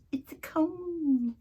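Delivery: high, slow, drawn-out reply; hammed-up film catchphrase; movie quote. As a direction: stretch CONE out in a high put-on voice. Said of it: "and he goes".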